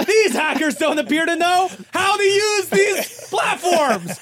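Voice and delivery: high, laughing mock outrage; mock news outrage. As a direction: play up the scandal, high and giggly. Mock outrage about hackers.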